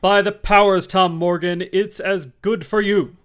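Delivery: loud, high gruff outburst; exclaiming. A narrator's reading. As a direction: exclaim it roughly. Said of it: "exclaimed the landlord".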